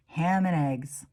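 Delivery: ordinary delivery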